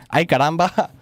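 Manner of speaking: put-on a light gringo accent on Ay, caramba. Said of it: "I say that with a gringo accent".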